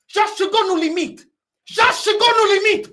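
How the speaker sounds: real exasperated fan's outburst, high and loud; fed-up anger. Real, not acted. Fed-up football fan rant, rising shout.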